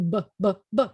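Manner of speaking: B, B with the voice on; voiced B drills; voiced B demo. Shown as a demonstration. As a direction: let sound come out on B. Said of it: "you make sound come out".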